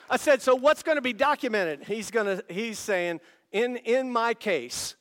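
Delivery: high, loud, excited sermon shout; triumphant testimony at full power; full-force preaching. Real, not acted. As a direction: burst out loudly, pitch well up.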